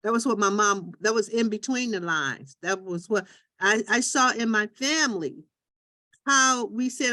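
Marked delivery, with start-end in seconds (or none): none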